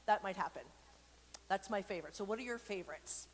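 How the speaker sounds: usual speaking voice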